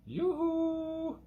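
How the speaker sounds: high falsetto yoo-hoo, held long; sing-song call. Acted. Host mocking the sponsor's ad, with a high falsetto yoo-hoo. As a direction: trill it way up like a cartoon jingle.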